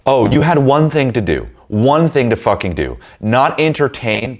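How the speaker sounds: slow, high-pitched scolding; exasperated. Retold or imitated. Telling her she had one thing to do, exasperated. Berating her in retold anger.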